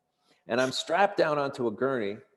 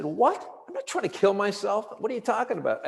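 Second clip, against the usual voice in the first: high-pitched retold disbelief; incredulous protest; 7 semitones higher than usual. Patient answering the intake nurse's accusation, incredulous protest.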